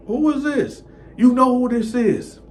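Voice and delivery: high, loud sassy woman on the phone; girlfriend's voice. Retold or imitated. Angry girlfriend on the phone, voiced high and loud. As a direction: put on her high demanding voice.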